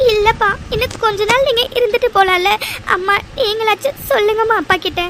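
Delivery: high, tearful begging; crying like a child. Acted. Daughter appealing to both parents to stay, crying. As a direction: beg like a weeping child, pitched well up. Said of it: "இப்படி குழந்தை மாதிரி அழுதுட்டு இருக்க".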